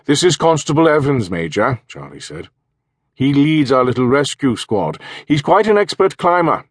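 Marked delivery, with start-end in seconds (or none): 0.1-1.8 raised, brighter local villager voice; a cheerful Welsh villager making introductions; Charlie's voice. Acted. Charlie speaks in story. 3.1-6.7 raised, brighter local villager voice; a cheerful Welsh villager making introductions; Charlie's voice. Acted. Charlie speaks in story.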